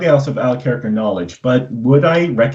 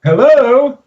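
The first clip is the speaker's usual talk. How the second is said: calling out; 10 semitones higher than usual. Acted. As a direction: call out to the tavern. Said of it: "but I call out".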